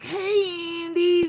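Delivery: very high, loud squealed hello; mock fawning greeting. Mocking fans greeting Andy with a squeal. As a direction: squeal hi like a besotted fan. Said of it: "it's like".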